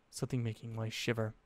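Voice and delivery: ordinary delivery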